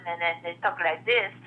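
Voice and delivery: demonstrating a French Canadian lilt; French Canadian accent. Shown as a demonstration. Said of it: "only accent I can do".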